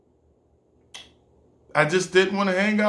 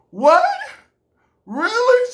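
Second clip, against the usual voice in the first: shocked disbelief; 6 dB louder, slower than usual. Retold or imitated. Friend admits week away with guy.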